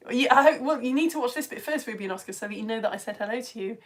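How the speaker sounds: ordinary delivery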